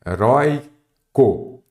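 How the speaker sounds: "Heróico" split as he... rói... co, with pauses; slow teacher's breakdown of "Heróico", he / RÓI / co; syllable-by-syllable demo. Shown as a demonstration. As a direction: sound out "Heróico" slowly in three separate syllables. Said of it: "Vamos lá?".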